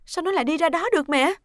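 hurried, agitated questioning; anxious urgency. Acted. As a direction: demand an explanation, worried sick. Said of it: "Nguyệt sốt sắng".

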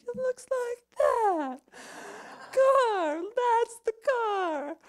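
high, loud, slow cry of recognition; excited shout. Retold or imitated. Seeing her stolen car roll past, she shouts out in high, loud disbelief. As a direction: call it out as the stolen car appears.

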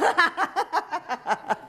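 high, rapid burst of laughter; long amused cackle; real laugh. Real, not acted. Laughs after asking for rowdy group.

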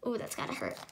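soft breathy aside, wincing; wincing whisper. Real, not acted. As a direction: whisper it like you feel the sting. Reader winces at porcupine picture.

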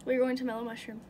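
ordinary talk, nothing marked

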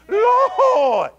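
loud, high, drawn-out shout; long preacher's holler, way up high; crying out to God. Acted. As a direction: belt out the word in praise.

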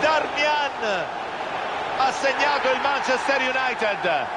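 high, drawn-out goal call; breathless italian goal commentary. Real, not acted. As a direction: shout the scorer's name, then stretch it out.

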